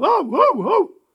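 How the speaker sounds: high loud puppy bark. Acted. Puppy runs about the yard barking loudly. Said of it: "громко лаял".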